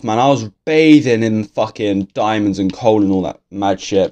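ordinary talk, nothing marked

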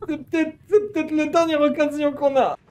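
high loud exasperated ranting; heated insisting. Real, not acted. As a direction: insist loudly, half laughing. (insistance exaltée)

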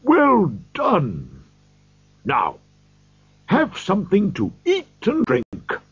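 a mild hearty Fezziwig feeding his guests; cheerful call. A narrator's reading. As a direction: praise the dancers heartily. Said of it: "old Fezziwig cried out".

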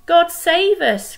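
desperate cry. Acted. Second sailor pleading in the storm, desperate cry. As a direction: beg heaven at the top of the voice. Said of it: "cried another".

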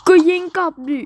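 high, loud frantic shout; frantic yell. Acted. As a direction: scream the order to fire back. Soldier yells to shoot back. (ตะโกนสั่งยิงกลับ)

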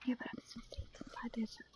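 breathy whisper, no voice. Real, not acted. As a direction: murmur it to stay hidden. Hushed while sneaking in game.

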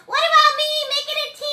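very high, loud, fast puppet voice; squeaky puppet character. Pretend. Puppet butting in to ask teacher, high fast voice. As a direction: pipe up loudly in a tiny high voice.

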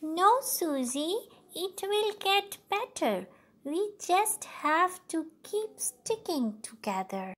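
put-on an older sister encouraging her little sister; big sister Millie. Millie reassures Susie.

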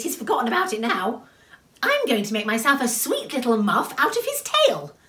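high, offhand little voice; careless. Acted. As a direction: shrug it off, cheeky. Little My shrugs off death.

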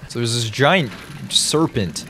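ordinary talk

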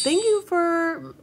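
high, drawn-out thank-you; real gratitude, pitched high; gushing thanks. Real, not acted. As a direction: gush thanks, slow and way up high. Thanking a viewer for a gift.

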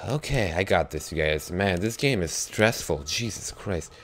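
ordinary talk

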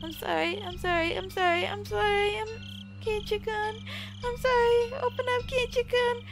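put-on girl begging Keiichi to open up; desperate pleading. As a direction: beg through the door, climbing. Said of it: "apologizing profusely".

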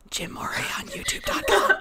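breathy, giggling stage whisper; laughing whisper. Real, not acted. Player sneaking in a plug for the DM, laughing whisper.

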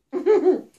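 short, high chuckle; loud little burst of laughter. Real, not acted. Chuckles after describing the romance.

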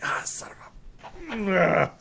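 drawn-out grumbled curse, voiced for a character; cursing. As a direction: play a frustrated alchemist cursing his spill. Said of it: "he starts cursing".

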